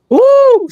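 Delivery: high, loud whoop after the rant; fired-up woo. Real, not acted. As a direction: let out a whoop to blow off steam. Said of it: "I'm on fire".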